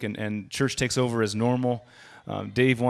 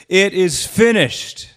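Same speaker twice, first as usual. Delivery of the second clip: slow, loud, higher cry; 9 semitones higher, 10 dB louder, much slower than usual. Retold or imitated. Jesus on the cross crying out, slow and loud. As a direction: draw it out as a final cry. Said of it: "He cried out with a loud voice".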